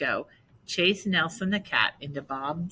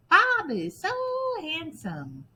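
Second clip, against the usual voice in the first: parrot echo; 13 semitones higher, 3 dB louder than usual. Acted. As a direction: squawk it back like a parrot. Parrot echoes Martha.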